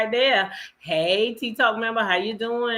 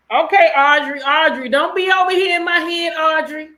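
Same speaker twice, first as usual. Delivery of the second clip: mock scolding shout; 7 semitones higher, 7 dB louder than usual. Real, not acted. Playfully scolding a chatter by name.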